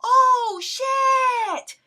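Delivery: a hammed-up gleeful cry; gleeful exclaim. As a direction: crow it out with delight. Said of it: "I respond".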